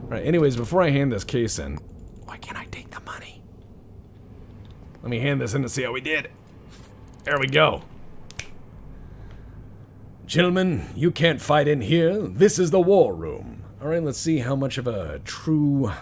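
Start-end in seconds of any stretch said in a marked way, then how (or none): 2.2-3.4 conspiratorial whisper. Pretend. Whispered greedy aside. 10.3-13.6 lightly voiced stern president; a hint of the movie line; Doctor Strangelove quote. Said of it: "I love Doctor Strangelove".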